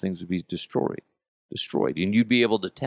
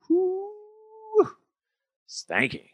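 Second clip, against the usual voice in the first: mock disgust whoop; much slower than usual. Real, not acted. Reacting to smelly baby poop.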